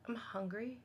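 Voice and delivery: soft, sheepish reply; ashamed admission. Retold or imitated. Hungry man answering a stranger, quietly ashamed. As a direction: imitate someone quietly embarrassed to admit it. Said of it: "he was kind of ashamed of it".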